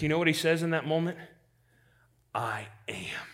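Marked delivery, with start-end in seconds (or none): 2.3-3.3 whispered, much softer; hushed whisper. Acted. Jesus answering the arresting soldiers in a hushed whisper. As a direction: breathe the words with awe.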